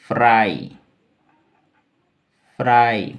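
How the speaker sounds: फ्राई said firmly with a long "aai"; pronunciation demo. Shown as a demonstration. Teacher showing how this is said, careful demo of फ्राई. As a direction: model फ्राई, each time as a separate emphatic word. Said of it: "इसको बोलेंगे".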